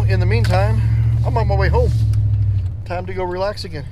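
normal talking voice

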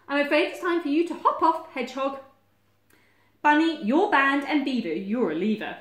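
bossy storybook judge; banishing Badger. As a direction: hand out verdicts loudly and firmly.

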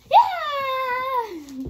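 long high-pitched scream; gleeful mock scream at full voice; playful scream. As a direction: let out a loud, high, drawn-out yell. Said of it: "That's why I'm screaming".

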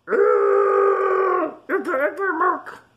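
very high turtle voice; squeaky, high storybook turtle. A narrator's reading. Turtle complains about the snoring, high squeaky voice. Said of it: "says the turtle".

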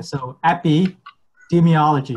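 epidemiology said slowly, syllable by syllable; slow demo. Shown as a demonstration. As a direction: spell out epidemiology slowly for the learner. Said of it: "gotta say slowly".

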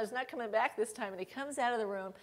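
ordinary talk, nothing marked

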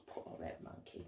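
very soft, whispered sympathy; hushed pity. Pretend. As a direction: murmur it tenderly under the breath. Narrator pities crying monkey.

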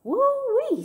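high, loud whoop; exclaimed storybook whoop. A narrator's reading. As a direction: let out a big soaring whoop. Gloria exclaims about the storm.

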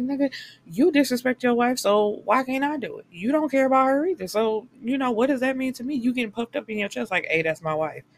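hammed-up outrage at a hypocrite; mock indignant comeback. Mistress snapping back at married man.

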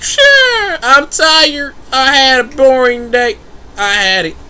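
high, whimpering complaint; whiny crying voice. Retold or imitated. The sim fake-sobbing about being woken. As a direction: whine it like a tired sim near tears.

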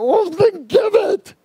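very high, drawn-out loud wail; high-pitched, impatient mock yell; exasperated inner cry. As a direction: let a pent-up thought burst out high and slow. Acting out his exasperated inner thought.

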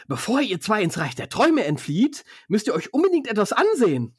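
high, eager put-on voice; eager character voice. Stans urging two tired men to look, high eager voice. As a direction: urge them on, pitched well up.

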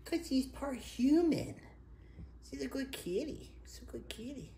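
high cooing pet voice; sweet talking to a pet. Real, not acted. Baby talk to the cat.

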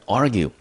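"argue" said as a careful model word; pronunciation demo. Shown as a demonstration. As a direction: model "argue" clearly for learners. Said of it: "我们念成". (示范念argue，咬字清楚)

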